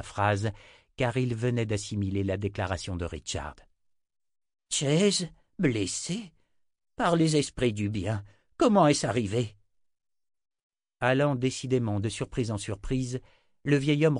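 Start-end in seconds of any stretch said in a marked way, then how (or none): 4.7-9.6 startled high questions, then louder; shocked. A narrator's reading. Old wizard learns his friend is wounded, shocked. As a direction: react aloud to bad news.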